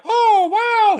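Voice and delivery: very high, loud falsetto squeal; Mickey Mouse. Acted. Stand-in auditioning the voice for the real actor, squeaky mouse falsetto. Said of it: "Did you do the voice?".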